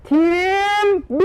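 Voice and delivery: high, drawn-out game-show call; big, high-pitched reveal of the winner; winner announcement. Real, not acted. Announcing the winning team to applause.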